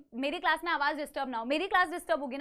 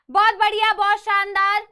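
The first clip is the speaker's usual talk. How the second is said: loud, beaming praise for the class; excited praise; 3 semitones higher, 10 dB louder than usual. Real, not acted. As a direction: cheer the students on, much louder. Teacher praising the class loudly. (जोश से ज़ोरदार तारीफ़)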